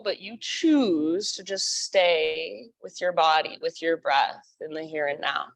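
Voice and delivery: calm meditation guide voice; guided meditation. Acted.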